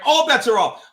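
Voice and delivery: high, loud mocking copy; exaggerated imitation of an ultimatum; mimicked threat. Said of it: "Listen to the intonation in Psycho's voice".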